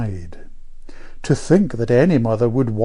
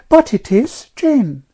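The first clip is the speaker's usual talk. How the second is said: put-on high, louder insistent girl; protesting child; 12 semitones higher, 6 dB louder, slower than usual. A narrator's reading. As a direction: insist like a contradicted little know-all. Amelia protests at being doubted.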